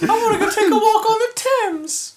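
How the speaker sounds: put-on high British baby accent; squeaky British accent. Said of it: "He's British".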